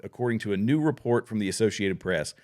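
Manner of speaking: ordinary talk, nothing marked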